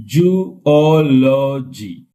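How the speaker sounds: "Zoology" very slow and loud, zo-o-lo-gy; syllable by syllable. Shown as a demonstration. Teacher counting syllables for class, slow loud split word. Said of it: "One, two, three".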